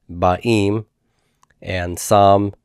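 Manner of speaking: normal talking voice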